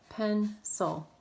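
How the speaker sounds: "Pencil" broken between N and C; split into syllables. Shown as a demonstration. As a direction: say "Pencil" as pen, pause, cil. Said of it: "break it apart between the two consonants".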